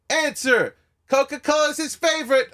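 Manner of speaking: high, hollered mock triumph; excited announcing. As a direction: announce the answer like a huge win. Acts out Dice's excited reaction.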